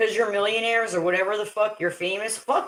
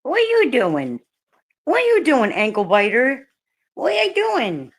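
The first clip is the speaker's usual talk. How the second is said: much higher, louder, sing-song scolding; playful pet talk; 6 semitones higher, 3 dB louder than usual. Real, not acted. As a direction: tease the puppy in a high voice. Scolding the biting dog playfully.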